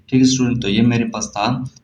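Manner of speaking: ordinary talk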